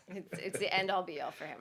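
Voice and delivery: usual speaking voice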